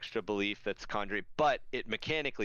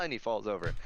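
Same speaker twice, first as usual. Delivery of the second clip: ordinary delivery, nothing marked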